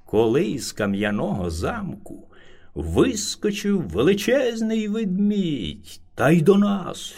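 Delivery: plain reading voice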